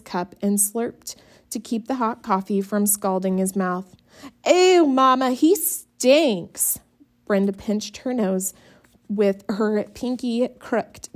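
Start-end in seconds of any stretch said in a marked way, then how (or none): 4.5-6.8 higher, louder whiny disgust; disgusted complaint. A narrator's reading. Brenda complaining to Mama, disgusted and whiny.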